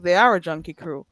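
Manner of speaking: ordinary talk, nothing marked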